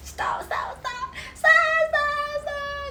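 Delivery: whispered stops leaping into high squeals; playful protest. Pretend. Streamer fending off viewers' compliments, playful protest. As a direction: squeal it in mock embarrassment.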